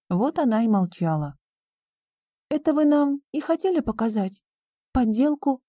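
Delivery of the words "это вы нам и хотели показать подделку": put-on high-pitched girl, pointed question; questioning young heroine. A narrator's reading. Риона questions the businessman about the fake, incredulous. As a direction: ask in the girl's raised voice.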